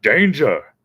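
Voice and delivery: a first, milder Danger! before the big one; warning shout. Retold or imitated. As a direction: call out Danger! as a ride warning. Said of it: "the speaker would be like".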